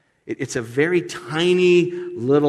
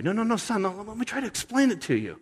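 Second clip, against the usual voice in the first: soft, gentle fatherly reasoning, pitched higher; a tender father calming his stubborn son; gentle God; 9 semitones higher, 4 dB quieter, faster than usual. Acted. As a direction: coax him gently like a patient father. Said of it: "And so God is so gentle".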